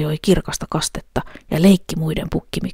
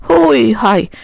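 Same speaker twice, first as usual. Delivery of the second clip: loud, higher goat cry; big goat shouting; 6 semitones higher, 7 dB louder than usual. A narrator's reading. Big goat daring the troll, loud call. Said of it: "sanoi pukkimikko".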